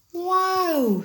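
high, amazed owl voice; a little owl gasping in wonder; wise hooting owl. A narrator's reading. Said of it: "said the owl".

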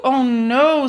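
high, fed-up bear voice; a tired bear at the end of his rope; Mister Bear groaning at the noise; weary Mister Bear. Acted. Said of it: "said Mister Bear".

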